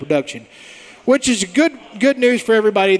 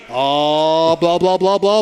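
midnight snack voice; slower than usual. Shown as a demonstration. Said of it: "The midnight snack Colby voice".

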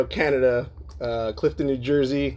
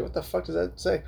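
high, soft puzzled aside; retold bafflement; 5 semitones higher, 5 dB quieter than usual. Reader faced with Google translated emails, mock-baffled. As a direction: act out puzzlement, pitched up and softer.

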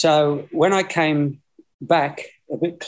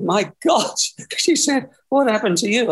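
put-on shocked old lady; 9 semitones higher than usual. Shocked landlady retold.

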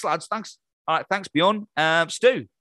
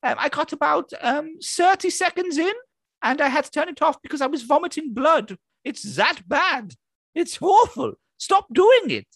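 squeaky insults, rising to a higher yell; Anna-Frid impression; 10 semitones higher than usual. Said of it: "Anna Fried".

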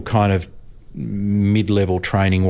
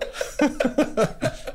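soft airy laugh fading; breathless falling laugh; 6 dB quieter than usual. Real, not acted. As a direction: let an unvoiced laugh fall away. Laughter ends anecdote.

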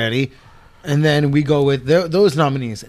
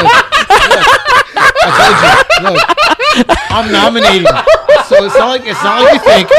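laughing; 3 dB louder than usual. Real, not acted. Excited laughing pitch.

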